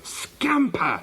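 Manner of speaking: high-pitched furious call to the dog; shouted. A narrator's reading. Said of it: "she shouted".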